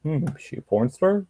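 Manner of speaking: a light, joking aside to viewers; wry wondering. Retold or imitated. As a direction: raise the pitch in mock puzzlement. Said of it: "So I'm like".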